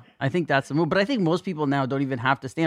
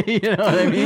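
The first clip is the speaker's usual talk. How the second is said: laughing; 6 semitones higher, 4 dB louder than usual. Real, not acted. Laughing at own story.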